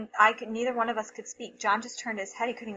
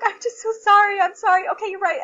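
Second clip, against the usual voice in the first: high, loud apologizing in a rush of emotion; retold tearful apology; 10 semitones higher, 10 dB louder than usual. Crying mom apologizing to her son, flustered. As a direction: replay a crying mom's apology. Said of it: "And I was like".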